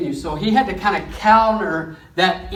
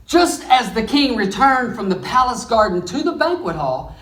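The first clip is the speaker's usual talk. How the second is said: ordinary talk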